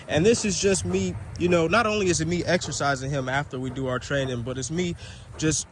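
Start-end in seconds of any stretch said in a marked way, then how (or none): none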